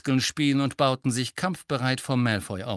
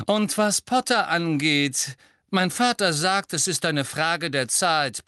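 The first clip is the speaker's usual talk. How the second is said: higher, louder mocking schoolboy voice; Malfoy sneering; 7 semitones higher, 3 dB louder than usual. A narrator's reading. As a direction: taunt them with a raised voice. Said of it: "hob die Stimme noch ein wenig".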